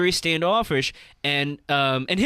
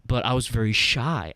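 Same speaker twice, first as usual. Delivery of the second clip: lower voice of a shy comic; 7 semitones lower than usual. Retold or imitated. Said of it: "he's like".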